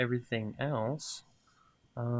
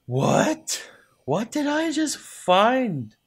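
loud, high-pitched disbelief; thrilled shock at a lucky find; astonished; 10 semitones higher, 9 dB louder, much slower than usual. Real, not acted. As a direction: cry out, stretching the words in amazement. Sudden find in the game.

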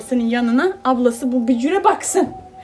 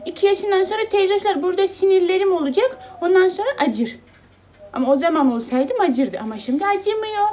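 higher, louder voicing of the baby; speaking as the baby; 7 semitones higher, 4 dB louder than usual. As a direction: speak for the baby, brightly and up high. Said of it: "Doktor amcam öyle söyledi". (bebeğin ağzından ince, canlı sesle)

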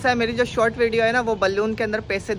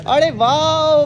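excited cheer; 5 semitones higher, 8 dB louder, much slower than usual. Real, not acted. Cheering the pet's kick.

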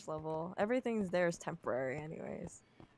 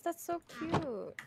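much higher, slow gushing; cooing; 8 semitones higher, slower than usual. Real, not acted. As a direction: coo it like at a puppy. Hearing about a dolphin.

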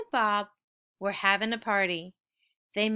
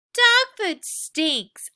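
higher, louder, slow disgust; 7 semitones higher, 8 dB louder, slower than usual. A narrator's reading. Puppy grossed out by her own bowl, disgusted. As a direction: drag it out, revolted.

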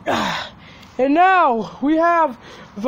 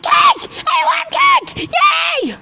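much higher, loud cake cheer; excited cheer; 12 semitones higher, 3 dB louder, much slower than usual. Acted. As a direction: scream for joy about cake. Toy hears about cake, cheers.

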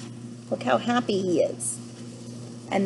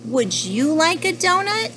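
high, slow, lilting question; bright offer; 8 semitones higher, 3 dB louder than usual. Acted. Mister Panda offers the next animal a donut, high lilting offer. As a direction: offer it in a raised storybook voice.

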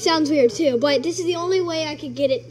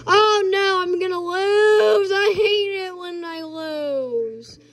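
slow whiny wail about losing; 6 semitones higher, much slower than usual. Real, not acted. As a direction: draw it out in a whine. Losing the game, upset.

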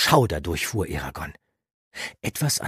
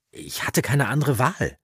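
put-on higher, defensive young voice; defensive youth; 8 semitones higher, 3 dB louder than usual. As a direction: defend yourself against the old man. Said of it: "verteidigte sich Eragon". (verteidigend, höhere junge Stimme)

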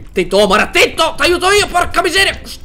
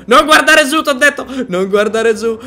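ordinary talk, nothing marked

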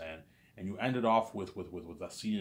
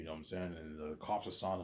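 ordinary talk, nothing marked

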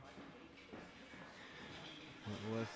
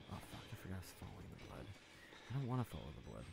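much softer, fast self-mutter; muttered aside; 3 semitones lower, 11 dB quieter, much faster than usual. Real, not acted. Player muttering to self, soft fast aside. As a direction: mumble it to yourself quickly.

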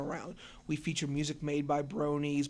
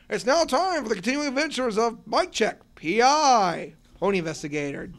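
big, high announcer voice; radio announcer; 6 semitones higher, 7 dB louder than usual. Acted. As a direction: boom out the show intro.

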